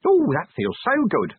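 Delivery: high-pitched little imp, slow and relieved; drawn-out, high creature voice. Acted. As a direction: luxuriate in relief as a small imp. Imp settles into its box.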